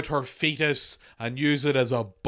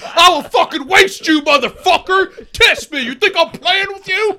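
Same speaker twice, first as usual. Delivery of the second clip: much higher, much louder furious yelling; hammed-up rage; mock screaming cop; 14 semitones higher, 10 dB louder than usual. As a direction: scream threats like a crazed cop. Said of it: "Points a gun in his face".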